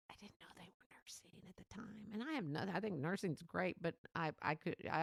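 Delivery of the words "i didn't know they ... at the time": hushed whispered confession. Real, not acted. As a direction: whisper it like an embarrassing secret. Embarrassed confession aside.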